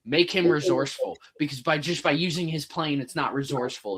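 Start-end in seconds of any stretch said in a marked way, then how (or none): none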